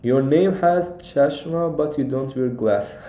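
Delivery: normal talking voice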